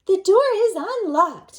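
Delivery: put-on high, loud old granny; granny calling to the door. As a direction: answer like a granny. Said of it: "said Granny".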